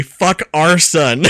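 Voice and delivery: Fuck our son said slowly, every word separate; slow and deliberate. Retold or imitated. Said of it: "writes back immediately".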